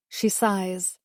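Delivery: ordinary delivery, nothing marked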